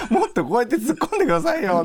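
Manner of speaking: high-pitched whiny plea, laughing; whiny pleading. Retold or imitated. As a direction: beg him to play along, laughing. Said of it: "歌丸さんに対して".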